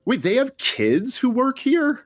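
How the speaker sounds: put-on high, slow, wide-eyed child voice; a child blurting out a question at a hotel; loud kid. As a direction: ask it like an amazed six-year-old. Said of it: "she just goes so loud".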